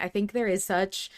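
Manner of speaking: usual speaking voice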